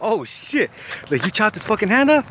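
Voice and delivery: high-pitched, astonished question; shocked disbelief. Retold or imitated. Narrator hears of chopped hand, shocked disbelief. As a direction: replay a stunned, incredulous reaction. Retelling his shocked reaction.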